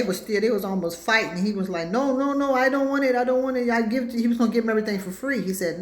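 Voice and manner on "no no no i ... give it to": high, insistent refusal; protesting shopkeeper. Retold or imitated. Store man refusing the card, high insistent protest. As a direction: refuse the money over and over.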